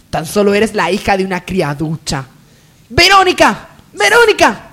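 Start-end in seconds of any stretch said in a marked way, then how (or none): 2.9-3.7 high call across the house. Acted. Lady calls her maid from the hall, loud call. 3.9-4.7 impatient call. Acted. Lady calls her maid again, higher impatient yell.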